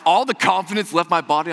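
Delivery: usual speaking voice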